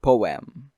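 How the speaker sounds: "Poem" said as poh-EM, both vowels clear; "Poem" with the second syllable opening on a vowel; two-syllable poem. Shown as a demonstration. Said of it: "second syllable starts with a vowel".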